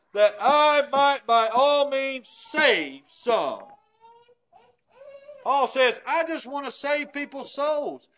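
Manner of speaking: fervent preacher proclaiming scripture; full-force preaching. Acted. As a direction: preach it out, rising and pressing.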